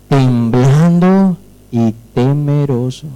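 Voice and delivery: TEMBLANDO and TEMEROSO stretched slowly, with a pause between; drawn-out emphasis. Shown as a demonstration. Preacher reminding the church what scripture says, "temblando y temeroso" slow and stretched. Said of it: "¿Cómo dice la palabra?".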